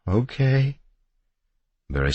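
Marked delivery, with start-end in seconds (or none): 0.1-0.8 high, hesitant agreement; tentative, high-pitched woman's reply; fearful assent. A narrator's reading. Frightened wife hesitantly agreeing.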